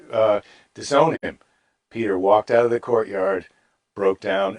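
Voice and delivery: ordinary delivery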